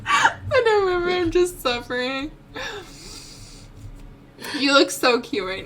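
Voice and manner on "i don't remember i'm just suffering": mock whiny echo. Mocking friend's answer.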